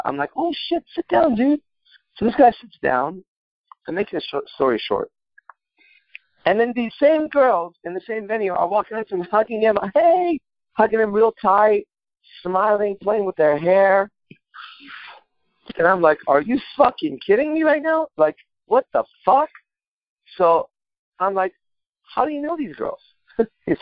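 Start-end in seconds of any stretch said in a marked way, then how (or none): 0.3-1.6 much higher, delighted oh shit sit down; excited greeting. Retold or imitated. Recalling meeting high school friend, excited high greeting. 9.9-10.4 much higher, squealed hey; girly greeting. Retold or imitated. Girls in the club greeting him, high squealing hey. 16.3-19.5 much higher, incredulous are you kidding me; shocked disbelief. Retold or imitated. Seeing the same girls hug his friend, incredulous.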